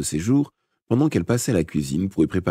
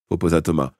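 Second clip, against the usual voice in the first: usual speaking voice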